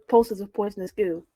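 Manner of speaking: normal talking voice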